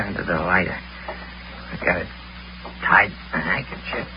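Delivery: unvoiced, secretive whisper. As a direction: hush it like a secret. Shows secret item quietly.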